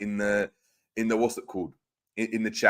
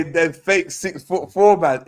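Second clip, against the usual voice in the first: mocking a chat comment; 5 semitones higher, 5 dB louder, slower than usual. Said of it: "And he say".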